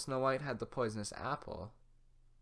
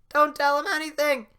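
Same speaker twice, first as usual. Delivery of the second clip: frantic shout; 14 semitones higher, 13 dB louder than usual. Acted. Gingerbread man shouting warning.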